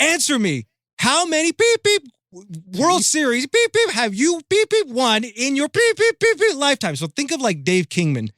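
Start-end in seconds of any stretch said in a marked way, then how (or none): 0.0-7.0 high-pitched mock tirade, pressing harder; Tommy Lasorda yelling. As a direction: imitate him demanding an answer, pitched way up. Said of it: "Now he's loud".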